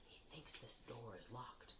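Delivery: soft, hushed relief; whispered. Acted. Harry whispers to friends behind the locked door. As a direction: keep it under Filch's hearing. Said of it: "Harry whispered".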